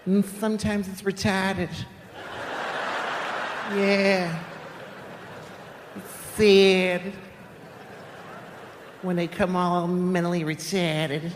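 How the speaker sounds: high-pitched Boston teacher; Boston-accented teacher. Acted. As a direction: carry on the lesson in a drawn-out Boston accent. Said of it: "I remember my fifth grade teacher".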